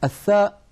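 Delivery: الثاء with a clear th sound, tongue tip between teeth; clear Arabic tha. Shown as a demonstration. Quran teacher models the letter for students, crisp demo. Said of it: "حرف عربي نطقه كما ذكرت الآن".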